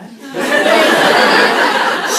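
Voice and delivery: loud burst of laughing; real loud laughing fit; loud laughter. Real, not acted. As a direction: break up laughing. Laughing reading her diary.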